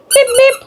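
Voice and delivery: high-pitched toy horn; playful horn noise. Pretend. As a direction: beep like a little car horn, pitched high. Imitating a horn for child's ride-on.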